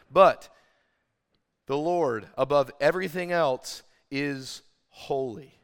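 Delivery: loud "But", then slow drawn-out "is holy"; slow down and land on "is holy"; building emphasis. Real, not acted. Preacher stressing God's holiness to the congregation, slowed. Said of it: "could not emphasize it anymore".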